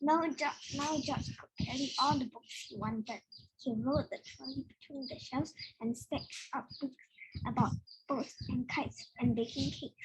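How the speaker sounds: usual speaking voice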